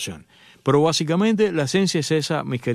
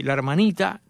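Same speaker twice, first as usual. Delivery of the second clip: ordinary talk, nothing marked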